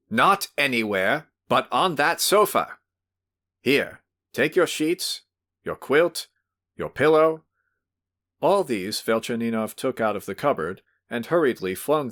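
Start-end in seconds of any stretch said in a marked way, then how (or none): 0.1-7.4 commanding. Acted. Host ordering him roughly.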